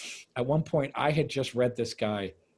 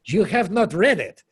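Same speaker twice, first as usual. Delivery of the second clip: imitated scolding, each word insisted on, higher and louder; angry Russian actress; 5 semitones higher, 8 dB louder than usual. Russian actress rejecting his excuse about new translations, angry. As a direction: retell her indignant contradiction. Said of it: "She got angry at me".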